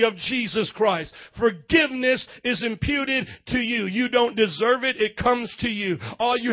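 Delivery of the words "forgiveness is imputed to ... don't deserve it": ordinary delivery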